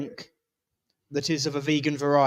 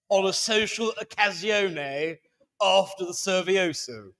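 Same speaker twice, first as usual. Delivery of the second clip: lightly put-on posh English accent, higher; mock posh English accent; 5 semitones higher, 4 dB louder than usual. Host joking he must use his posh accent, mock posh English accent. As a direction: play the posh lady announcing refreshments. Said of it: "use my proper accent".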